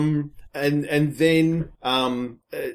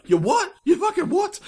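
high incredulous outburst climbing higher; retold disbelief. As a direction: squeak out the disbelief at the twist. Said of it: "I'm there going".

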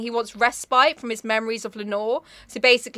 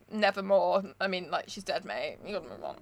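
deadpan Raven; 5 semitones lower, 11 dB quieter than usual. Retold or imitated. Raven telling the narrator Lenore is dead, low and deadpan. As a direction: lower and much softer, blunt and matter-of-fact. Raven bluntly tells him to move on.

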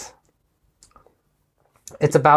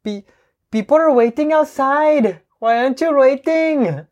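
higher, lively imitation of a Colombian Spanish accent; imitating a Colombian accent; 10 semitones higher than usual. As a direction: play Gloria-style Colombian English. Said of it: "I can imitate it". (コロンビア訛りの英語を高めの声で真似る)

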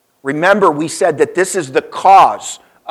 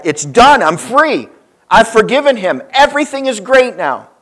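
rising higher, joyful release; elated relief; 6 semitones higher than usual. Retold or imitated. John running from the funeral home, rising joyful relief. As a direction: burst out free at last.

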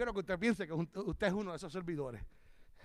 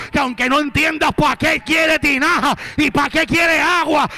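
loud, sustained sermon shouting; impassioned preacher rising higher toward the climax; full-force preaching; 6 semitones higher, 19 dB louder than usual. Real, not acted. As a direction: drive each phrase home at the top of your voice.

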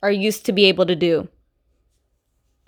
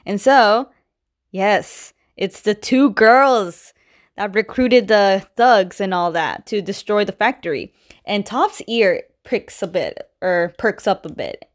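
normal talking voice

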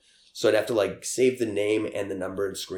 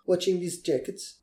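broken English; 9 semitones higher than usual. Retold or imitated. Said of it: "spoke broken English".

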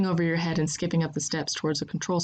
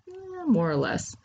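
considering; 11 semitones higher, slower than usual. A narrator's reading. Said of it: "wagging her head back and forth as if considering it".

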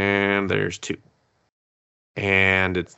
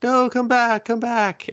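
high, pleading come back; mock plea, pitched way up; pleading call; 14 semitones higher than usual. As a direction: call after her, begging. Character begging her to return.

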